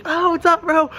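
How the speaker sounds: high-pitched yell without full volume; mock yell. Greeting Groms out the car window, high mock yell. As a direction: re-enact calling out to passing riders. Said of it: "this kid's yelling out the window".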